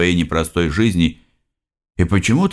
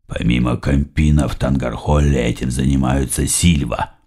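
lower, slightly gruff boss talking business; 7 semitones lower than usual. Acted. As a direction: voice Sam lower and heavier. Boss Sam explains plans.

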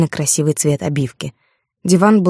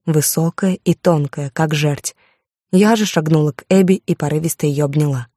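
usual speaking voice

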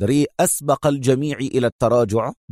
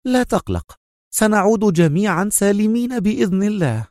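high-pitched woman's reassurance; calm Mona; 10 semitones higher than usual. A narrator's reading. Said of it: "قالت منى في هدوء".

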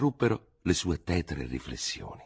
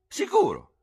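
high, loud curt answer; lieutenant shouting up to the doctor; shouted; 12 semitones higher, 4 dB louder than usual. Acted. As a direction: shout it back. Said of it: "gridò Dubert".

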